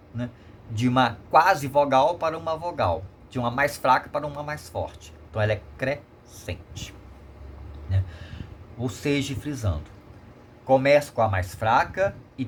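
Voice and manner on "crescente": "crescente" broken as "cre... scente"; split into syllables. Shown as a demonstration. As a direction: say "crescente" in two slow pieces, cre, then scente. Teacher breaks up the term.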